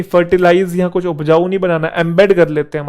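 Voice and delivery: ordinary talk, nothing marked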